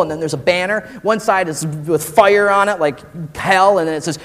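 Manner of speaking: normal talking voice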